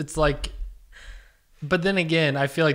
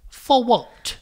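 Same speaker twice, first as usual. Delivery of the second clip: high, louder mock British accent; Harry Potter; 10 semitones higher, 6 dB louder than usual. Shop assistant asking the customer, high fake British accent. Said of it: "talk like Harry Potter".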